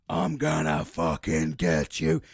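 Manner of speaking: low, slow, loud monster threat; menacing demon. Acted. Nightmare figure turning on the dreamer, menacing and deep. Nightmare man turns on him.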